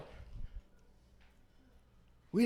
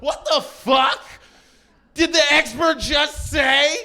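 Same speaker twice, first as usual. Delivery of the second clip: higher, slow, shouted; shocked disbelief; 8 semitones higher, slower than usual. Retold or imitated. Comic shocked at the expert's answer, slow high shout. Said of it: "And I was like".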